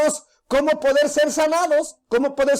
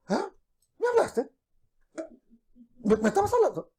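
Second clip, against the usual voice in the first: sorpresa fingida; 3 semitones higher, 5 dB quieter than usual. Acted. Hija distraída con el teléfono.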